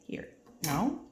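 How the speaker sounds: ordinary talk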